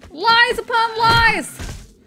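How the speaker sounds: panicked shrieking outburst; high startled yell. Real, not acted. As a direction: yell it high and drawn out as it jumps you. Startled outburst while fighting zombies.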